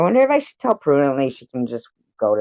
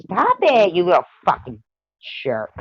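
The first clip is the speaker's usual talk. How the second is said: sharp high scold, louder; scolding the dog; 7 semitones higher, 4 dB louder than usual. Real, not acted. Owner snapping at her dog to stop, high angry scold.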